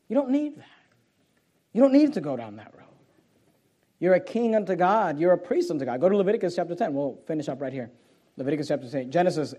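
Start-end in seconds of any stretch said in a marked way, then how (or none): none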